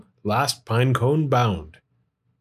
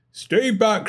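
higher warning call; light storybook shout; 7 semitones higher than usual. A narrator's reading. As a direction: warn him off. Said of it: "shouted Bruce".

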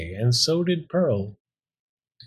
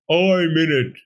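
higher, forceful mock growl; 5 semitones higher, 4 dB louder than usual. A narrator's reading. Talking bone warning off the robbers, growled. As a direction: growl it as a storybook threat. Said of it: "the bone growled".

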